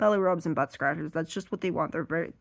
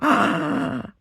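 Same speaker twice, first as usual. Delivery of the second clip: puppy gasp; 9 semitones higher, 7 dB louder than usual. Retold or imitated. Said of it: "They'd be like".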